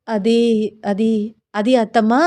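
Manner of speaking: halting, searching for an answer; flustered hesitation. A narrator's reading. Daughter-in-law stuck for an excuse to her mother-in-law, hesitant stammering. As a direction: stall on each word with pauses. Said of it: "ఏం చెప్పాలో అర్థం కాక".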